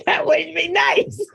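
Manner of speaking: high, giggling through it; laughing. Real, not acted. After joking about her family, she giggles at it. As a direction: laugh it off between the words.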